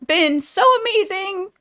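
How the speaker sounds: gushing thanks, choked up and excited; grateful youtuber getting emotional with her viewers. Real, not acted. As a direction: voice jumps high with emotion. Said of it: "Now I'm gonna get emotional".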